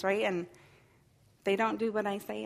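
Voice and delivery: ordinary delivery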